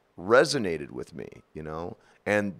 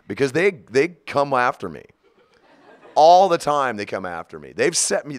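ordinary talk, nothing marked

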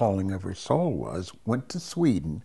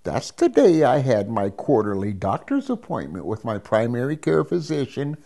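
ordinary talk, nothing marked

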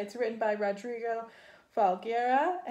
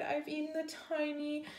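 high, lightly whimpered lament; mock crying dog; 5 semitones higher than usual. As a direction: pretend tearful baby talk. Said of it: "he cried".

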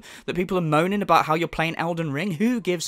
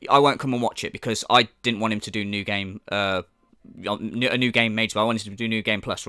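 lower, matter-of-fact imitated viewer; lightly voiced viewer; 6 semitones lower than usual. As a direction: play a viewer calmly bowing out. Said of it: "So people go".

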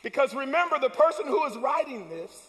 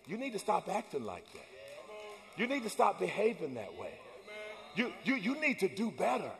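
much softer, lower inner voice; 5 semitones lower, 11 dB quieter than usual. Retold or imitated. Goodness and mercy voiced as a soft inner conscience. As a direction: murmur it like a conscience. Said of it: "they bring the words that say".

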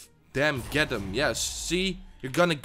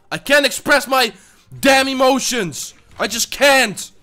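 higher, louder exasperated rant; frustrated outburst; 7 semitones higher, 6 dB louder than usual. Real, not acted. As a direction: vent the frustration at the game. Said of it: "I want to express my emotion".

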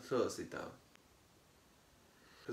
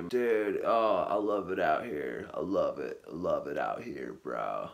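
lightly voiced laid-back California dude, lower; a hint of a California surfer bro; California surfer accent; 8 semitones lower than usual.

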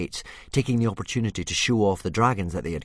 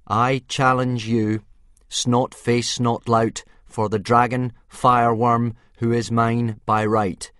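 louder, slow formal declaration; 5 semitones higher, 8 dB louder than usual. Acted. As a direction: proclaim the challenge to hide his nerves. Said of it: "speaking as loudly and formally as he could".

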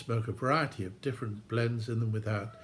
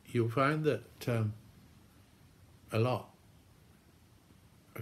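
talking around pipe stem; pipe in mouth. Real, not acted. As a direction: lips clamped on pipe. Seen 1: Pipe stem held between lips throughout while speaking.

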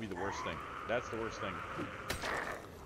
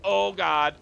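panicked yell; 10 semitones higher, 13 dB louder, much slower than usual. Real, not acted. Zombies on fire chase him.

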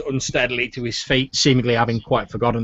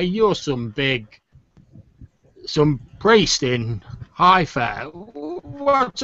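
put-on drunk man. Drunk ex-navy man recognizing a priest, drunkenly probing. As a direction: drag and stumble through it as a drunk. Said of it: "a really sort of drunk man".

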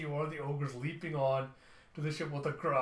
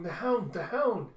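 excited shouting; 6 semitones higher, 6 dB louder than usual. Acted. Telepath crying out the name.

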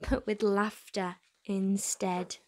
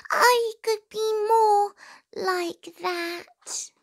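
high, slow, longing little mouse; a small mouse dreaming out loud; wistful mouse voice; 11 semitones higher, 4 dB louder, much slower than usual. A narrator's reading. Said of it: "thought Mouse".

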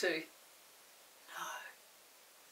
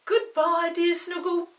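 louder, higher teasing goodbye; Chinky yelling a cheeky goodbye to the Snuggle; mock yelled farewell; 9 semitones higher, 9 dB louder, slower than usual. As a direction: call it out cheekily as you fly off. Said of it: "yelled Chinky".

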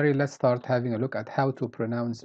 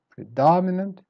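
"Predominant" raised on "dom"; weak, up, down; 5 semitones higher, 4 dB louder than usual. Shown as a demonstration. Teacher repeating for learners, "Predominant" with second-syllable stress. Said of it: "then up".

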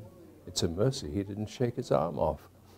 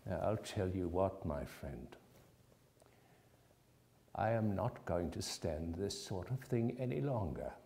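mock stern warning; 3 semitones lower, 10 dB quieter than usual. A narrator's reading. Scrooge pretending to scold Bob before the surprise. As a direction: lean in and threaten him quietly, in jest.

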